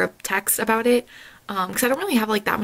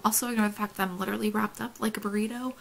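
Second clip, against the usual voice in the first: normal talking voice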